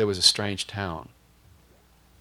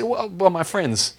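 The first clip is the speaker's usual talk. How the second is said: laughing sheepishly; 3 dB louder, much slower than usual. Retold or imitated. Sheepish reveal about friends.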